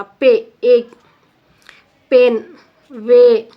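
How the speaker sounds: पे, एक, पेन, वे loud and separate, on the ay sound; slow, emphatic पेन, वे showing the ay glide; vowel sound demo. Shown as a demonstration. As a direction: call out पे, एक, पेन, वे as model words. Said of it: "ए किया sound आ रहा".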